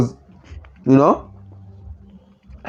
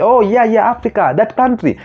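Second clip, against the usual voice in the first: mocking Americans; 7 semitones higher than usual. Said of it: "They will".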